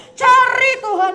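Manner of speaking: loud, high call to seek God; rousing cry; preaching at full force. Acted. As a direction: shout it out to the crowd.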